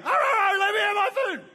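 high, loud barking dog voice; dog begging. Retold or imitated. Dog demanding its food, high and loud. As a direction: bark and beg like a dog. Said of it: "He starts again".

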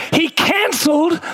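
slow, high, ringing declaration; triumphant preacher's cry; preaching shout. Real, not acted. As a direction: proclaim it with full force. Preaching climax.

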